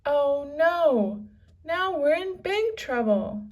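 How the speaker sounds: loud, high panicked cry; frightened boy calling out; alarmed cry. Acted. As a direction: yelp it as the storm hits. Wind starts, Jack alarmed.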